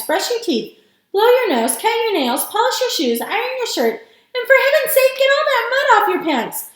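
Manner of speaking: worked-up, emphatic urging; excited shout. A narrator's reading. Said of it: "shouted Grandpa Joe".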